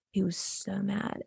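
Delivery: ordinary talk, nothing marked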